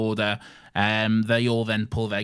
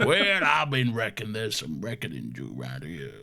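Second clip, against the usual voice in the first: gruff put-on cowboy drawl; Arthur Morgan. Podcaster showing off his cowboy voice, gruff drawl. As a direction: do a hammed-up gruff Western outlaw. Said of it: "my gruff cowboy voice, which is basically just Arthur Morgan".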